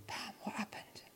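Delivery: hushed, awed whisper. Retold or imitated. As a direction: whisper it in disbelief. Young man asks in the car.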